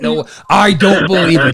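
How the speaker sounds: ordinary delivery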